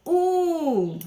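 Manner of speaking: put-on rushed, high, loud count like a hurried child; anxious child counting. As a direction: imitate a child racing through the count. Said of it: "eles começam assim". (contagem apressada de criança ansiosa)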